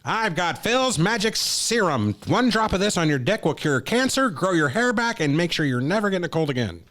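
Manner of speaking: high, slow carnival huckster pitch; snake oil seller. Retold or imitated. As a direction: sell a miracle cure like a showman. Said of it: "like, the late eighteen hundreds with".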